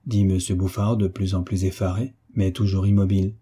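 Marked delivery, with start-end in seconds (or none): none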